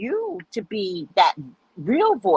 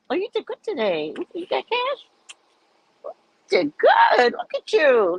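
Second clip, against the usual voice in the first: leaps far higher and louder, squealing with delight; excited high squeal; 9 semitones higher, 3 dB louder than usual. Pretend. Wife counting his earnings, high thrilled squeal. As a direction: gush over the cash like a thrilled wife.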